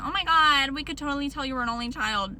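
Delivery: exaggerated "oh my God" reaction; mock exclaiming commenters. Mimics commenters exclaiming about her.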